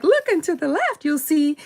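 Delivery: high tour guide Barbie voice; bright put-on doll voice; mock tour guide; imitating Barbie. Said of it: "'Cause she was like".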